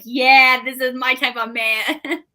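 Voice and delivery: delighted fan swoon. Retold or imitated. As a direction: gush over him like a crush. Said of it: "And I was like".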